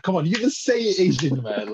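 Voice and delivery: high teasing egging on; teasing friend. Retold or imitated. Friend teasing a mate about his accent, high and playful. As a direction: coax him to say it, playfully.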